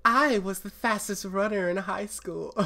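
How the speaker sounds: high, wobbly put-on girl voice; admittedly bad Daisy impression. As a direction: do a clumsy imitation of Daisy. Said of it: "I don't voice Daisy, so I'm bad".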